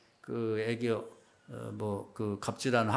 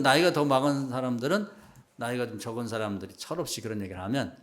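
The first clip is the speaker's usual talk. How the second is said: usual speaking voice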